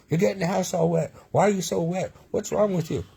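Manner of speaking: high-pitched mock yell of an angry father; dad yelling. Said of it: "he was yelling at me".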